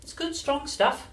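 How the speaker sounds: put-on unruffled storybook child; George reassuring Grandma. A narrator's reading. George answering in character.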